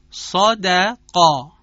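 صادقا slow and loud, full vowel on ص and ق, thin on د; heavy and light vowels. Shown as a demonstration. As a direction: model صادقا with dark "ɔ" on ص and ق, light "æ" on د. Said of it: "چاق ادا شد".

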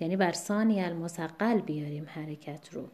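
usual speaking voice

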